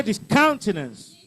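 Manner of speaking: discountenance, punching "count"; stress on second syllable. Shown as a demonstration. Teacher has class repeat the word, discountenance stressed on "count". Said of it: "Second syllable".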